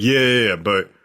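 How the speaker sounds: lightly voiced low action star; hint of a self-important movie tough guy; slightly put-on low celebrity voice; Vin Diesel. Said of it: "He's like".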